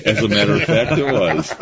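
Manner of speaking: answering through a real laugh. Real, not acted. Amused guest laughing at the host's guess, laughing. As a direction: laugh while you confirm it.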